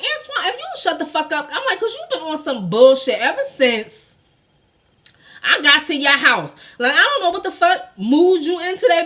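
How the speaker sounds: high-pitched, fed-up telling-off; retold telling-off.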